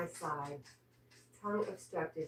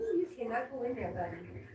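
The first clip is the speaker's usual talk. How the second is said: cooing warning; 7 semitones higher than usual. Real, not acted. Warning dog playfully.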